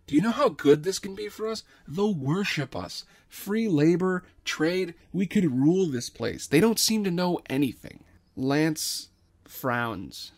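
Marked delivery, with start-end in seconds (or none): 0.1-8.0 high, fast, eager pitch; excited. Acted. Dredd eagerly pitching the scheme to Lance, excited. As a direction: sell the scheme, loud and giddy.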